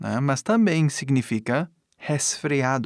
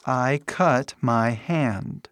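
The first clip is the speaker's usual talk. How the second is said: very slow, careful English example; slow model sentence; much slower than usual. Shown as a demonstration. Teacher models a cut sentence for students, slowly. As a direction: give each word its own space.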